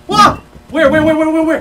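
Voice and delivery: high, loud frantic repeats; real panicked yelling. Real, not acted. Player spotting the monster, yelling in panic. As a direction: scramble to find the threat.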